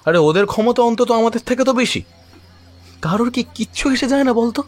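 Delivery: high-pitched, exasperated complaint; exasperated protest. Acted. Tamal complains to his friend that nobody cares, exasperated. Argument about losing the playground.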